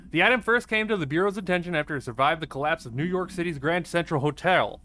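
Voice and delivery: usual speaking voice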